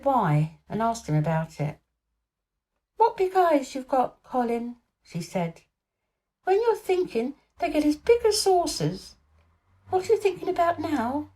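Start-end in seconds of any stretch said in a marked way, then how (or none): 3.0-11.3 put-on high, curious little girl; a bright girl teasing Colin gently; Mary, girl's voice. A narrator's reading. Mary asks Colin what he's thinking, high girl's voice.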